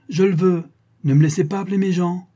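high, loud fatherly ultimatum; angry command. A narrator's reading. Baron threatening his disobedient son, angrily commanding.

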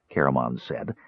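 ordinary delivery, nothing marked

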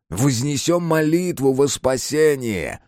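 high, slow prayer call; drawn-out liturgical appeal; chorus of monsters. A narrator's reading. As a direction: intone it like a fanatic congregation. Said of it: "выплеснулись десятки голосов, чужих и настоящих".

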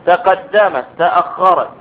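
normal talking voice

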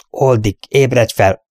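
usual speaking voice

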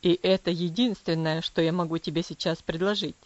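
low, cold father's voice; cool and distant, pitched low; coldly. Acted. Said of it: "холодно произнёс отец". (холодно, низким голосом отца)

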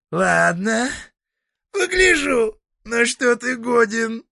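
high, creaky devil voice; a creaky, mocking old devil. Devil Dieter.

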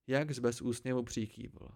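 usual speaking voice

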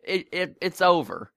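imitating an indignant kid; insistent kid. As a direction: play the twelve-year-old digging in. Kid's insistent denial retold.

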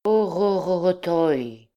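a drawn-out, hammed-up lament, slow; mock despairing wail. Student fakes despair to his brother, mock wailing. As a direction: fake a tragic Greek cry of grief. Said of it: "avec une expression de désespoir".

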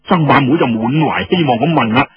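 ordinary delivery, nothing marked

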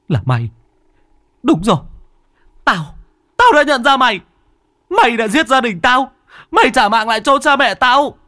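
high, loud screamed accusation; shrieking in fury. A narrator's reading. Orphaned boy accuses the demon of killing his family, furious shrieking. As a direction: build from recognition to raging screams. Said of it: "Nó rít lên".

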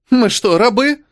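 high-pitched indignant question; indignant exclamation. A narrator's reading. Cynthia once the boss has left, indignant exclamation. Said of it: "воскликнула Синтия".

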